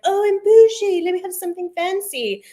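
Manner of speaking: high, loud mock bougie voice that drops after the first line; mock snobby. Mocking people who go French to seem fancy, in a high snooty voice. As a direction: put on a snooty fancy-lady air. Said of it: "are trying to be like".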